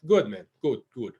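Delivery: lightly voiced Russian man; terse reply with a Russian accent; a hint of Russian English. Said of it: "he's Russian".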